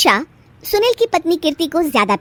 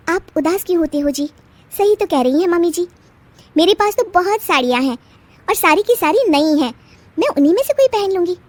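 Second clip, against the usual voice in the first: put-on higher, light young wife's voice; a young bride's higher voice, soothing her husband; sweet young wife; 6 semitones higher than usual. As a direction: reassure your husband brightly.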